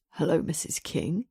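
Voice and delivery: low, gruff and sexy; Damien's deep gruff greeting. A narrator's reading. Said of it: "he says in his deep, gruff, sexy voice".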